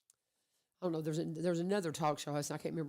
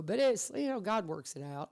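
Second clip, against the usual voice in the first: ordinary talk, nothing marked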